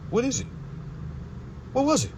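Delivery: high-pitched, incredulous little question. Real, not acted. Man needling listeners about who Jesus was, incredulous. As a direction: let the question jump up high.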